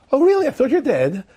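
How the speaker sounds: high, overdone surprise falling away; awkward polite surprise. Acted. Father-in-law to woman he thought dead, awkward surprise. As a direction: cover the shock with high-pitched politeness. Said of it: "How do you say that?".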